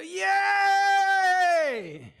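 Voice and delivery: high, loud whooping yay; private cheer. Pretend. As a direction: explode with joy alone in the car. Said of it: "Then you go in your car and you go".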